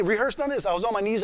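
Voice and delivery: usual speaking voice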